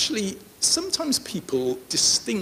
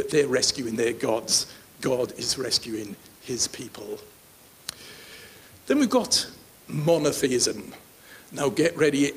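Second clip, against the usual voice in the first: ordinary delivery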